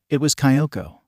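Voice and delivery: ordinary delivery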